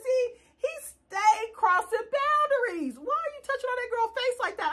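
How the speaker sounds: half-laughing, half-appalled; laughing disbelief. Retold or imitated.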